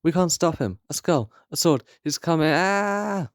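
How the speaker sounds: high, frantic, ending in a cry; panicked. Acted. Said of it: "A panicked voice says".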